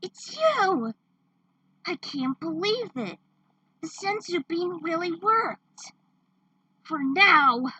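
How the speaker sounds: high, loud excited cries; overjoyed relief. Acted. Woman greeting her revived friend, high excited relief. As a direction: gush with relief.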